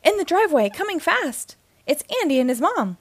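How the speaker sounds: high, urgent lookout's shout; shouted alarm. A narrator's reading. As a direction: call out the warning urgently. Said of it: "he shouted".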